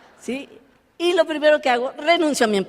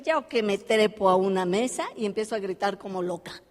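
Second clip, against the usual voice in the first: ordinary delivery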